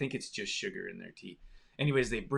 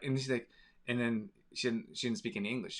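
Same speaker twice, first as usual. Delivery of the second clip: ordinary talk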